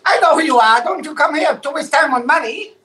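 mock Chinese accent of the shop owner; Chinese shopkeeper accent. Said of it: "I'm just talking like him".

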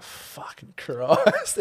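slow, drawn-out exasperation; exasperated. Retold or imitated. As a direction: sigh it out in disbelief. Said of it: "And I'm like".

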